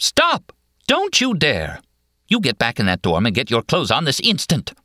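high, sharp scolding; indignant order that opens on a high cry; teacher bawling out. A narrator's reading. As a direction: scold like a teacher catching a student. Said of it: "She sounds like a teacher bawling out a student".

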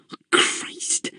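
exasperated whisper under the breath; whispered curse. A narrator's reading. Raymond cursing under his breath at Eleanor, whispered.

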